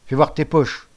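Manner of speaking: loud stern order; commanding. Acted. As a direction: bark the order at the boy. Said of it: "ordonna le père". (ordre sec et fort)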